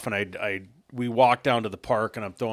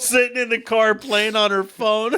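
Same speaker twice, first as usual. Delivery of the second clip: much higher and louder, laughing through the punchline; 14 semitones higher, 8 dB louder than usual. Real, not acted. Telling his cohost the twist, laughing hard. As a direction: tell it while cracking up.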